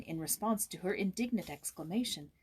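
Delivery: ordinary delivery, nothing marked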